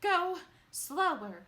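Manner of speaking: high, loud, slow gasping plea; panting. A narrator's reading. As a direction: beg between gasps for breath. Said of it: "panted Missus Salt".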